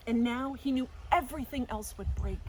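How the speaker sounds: plain reading voice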